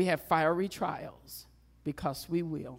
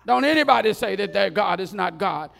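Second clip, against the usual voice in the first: a second royal bellow, slightly less; king's decree, repeated; 9 dB louder than usual. Acted. Preacher repeats the king's decree to the crowd, loud. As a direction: still booming but a notch down.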